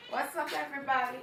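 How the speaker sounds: ordinary delivery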